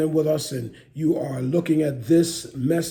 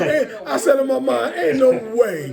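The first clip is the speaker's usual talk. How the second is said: amazed; 7 semitones higher, 4 dB louder than usual. Real, not acted. Retells his amazed reaction, laughing.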